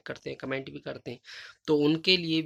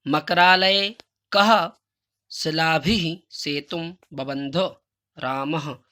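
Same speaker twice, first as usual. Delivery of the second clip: slow, loud recitation; 8 dB louder, much slower than usual. Shown as a demonstration. Teacher reads the answer loudly and slowly to students, measured recitation. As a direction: read each Sanskrit word slowly and loudly.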